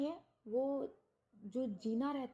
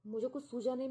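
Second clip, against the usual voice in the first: ordinary delivery, nothing marked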